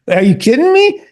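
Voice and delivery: high, loud "are you kidding me"; retold disbelief. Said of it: "I said".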